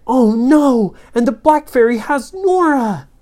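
high, loud cry of dismay; acted dismay. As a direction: sound the alarm for a captured friend. Children see friend captured.

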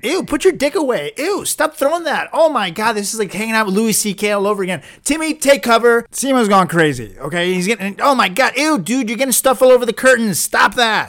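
high, loud disgusted yelling; alarmed, squealing "ew" outburst; grossed-out panic. Acted. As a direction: recoil and cry out in revulsion. Grossed-out host panics at guest.

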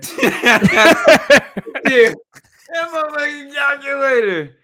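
high laughing yell; laughing outburst. Real, not acted. Mocking his friend's calculator trade, laughing loudly. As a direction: crack up and shout it out.

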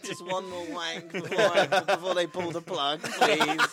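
high, laughing, pleading voice; begging through laughter; mock dying plea. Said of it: "dying man's last wish".

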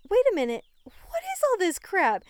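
loud, high outraged disbelief. Retold or imitated. Shouting at a greedy chicken breeder over fees.

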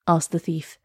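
plain reading voice

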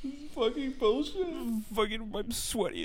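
high, strained mock whine; whiny complaining. A light imitation of himself griping to coworker, high whiny moan. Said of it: "I was like".

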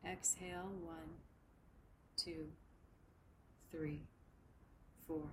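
slow, even counting with long pauses; slow breath counting. Shown as a demonstration. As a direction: count each breath slowly and softly. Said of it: "We'll begin to shape our breath together".

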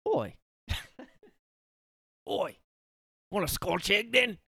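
high, hammed-up British hawker; British accent. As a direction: blurt it in exaggerated British. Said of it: "I can't do the accent".

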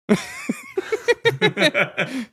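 long, very high snickering laugh; cartoon dog giggle. Scooby laughs at the pumpkins.

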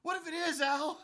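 loud, high, exaggerated protest; mock alarm. Answering the sergeant's hostage joke, loud mock alarm. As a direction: pitch it way up in mock outrage. Repeats mock retort with corrected name.

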